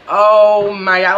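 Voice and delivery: slow, drawn-out embarrassed oh my God; mortified groan. Retold or imitated. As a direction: let the embarrassment drag every word out. Clerk laughing too, embarrassed.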